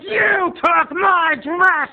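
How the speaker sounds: high, drawn-out squabbling screech; hammed-up sisters' fight, screamed slowly; screaming aunt. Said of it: "as Aunt Lauren was screaming".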